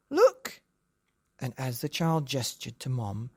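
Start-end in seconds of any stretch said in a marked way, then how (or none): 0.1-0.6 excited cry. Acted. Child points out the mouse.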